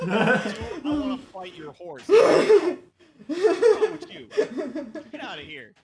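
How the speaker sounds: high, loud, laughing disbelief; laughing outburst. Real, not acted. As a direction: yell it through helpless laughter. Playful outburst at other player.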